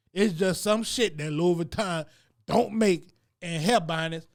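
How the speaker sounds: ordinary talk, nothing marked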